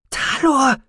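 high-pitched cry of the name; alarmed cry. Acted. Sister seeing brother threaten pilot, alarmed.

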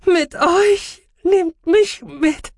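high, drawn-out begging; loud, slow, strained high voice; desperate plea. Acted. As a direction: beg them with all remaining strength. Patient pleads to be taken along.

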